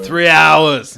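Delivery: loud, high bellowed answer; booming in-character kitchen boss; chef's shout. Acted. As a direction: bark it at the kitchen hand. Chef's shouted answer, acted by game master.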